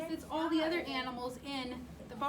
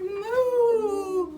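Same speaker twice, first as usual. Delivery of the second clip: loud, higher playful moo; cow moo; 6 semitones higher, 10 dB louder than usual. Acted. Reading to child, the confused cow moos loudly. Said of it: "who muttered".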